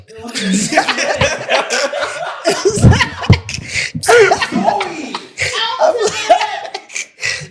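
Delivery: long, high laughing fit; helpless laughter. Real, not acted. Co-host breaking over an awkward question, long high laugh. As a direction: crack up loudly and keep going.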